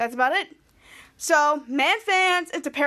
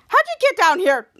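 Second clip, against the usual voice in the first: louder, raised; loud surprised question; 4 semitones higher, 7 dB louder than usual. Retold or imitated. Ray to Charlotte, loud surprised question. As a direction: exclaim in confusion.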